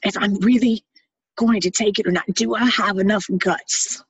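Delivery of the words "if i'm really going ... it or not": ordinary delivery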